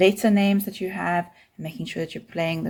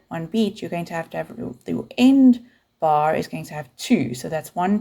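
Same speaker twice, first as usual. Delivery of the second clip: ordinary talk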